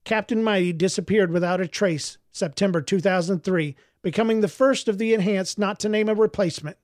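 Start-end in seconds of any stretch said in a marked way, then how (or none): none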